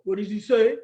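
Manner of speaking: put-on boy voice, low register; low-pitched boyish question; boys asking. A narrator's reading. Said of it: "The boys wanted to know".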